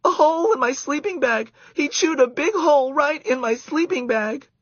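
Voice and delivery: high, loud outraged shriek; shrieking girl. A narrator's reading. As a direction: shriek at the chewed bag. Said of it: "shrieked Karen".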